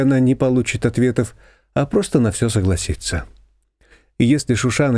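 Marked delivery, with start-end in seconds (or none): none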